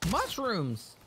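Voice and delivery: high, excited exclamation; sudden excited squeal of a word; real excitement. Real, not acted. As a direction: burst out high with delight. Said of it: "I got real excited".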